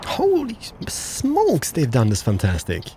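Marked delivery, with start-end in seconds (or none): 0.1-1.7 drawn out, high holy smokes; real awe at the view; amazed. Real, not acted. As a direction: marvel at it slowly, way up high. Amazed at new game scenery.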